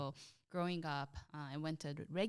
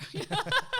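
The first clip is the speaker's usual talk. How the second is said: a burst of real laughter, high and loud; high bright laugh; 12 dB louder than usual. Real, not acted. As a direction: laugh out, amused at yourself. Amused at her own remark.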